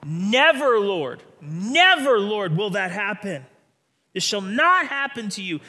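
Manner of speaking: NEVER held long and loud; high, loud Peter forbidding it; Peter's emphatic never. Acted. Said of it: "Matthew sixteen twenty-two says".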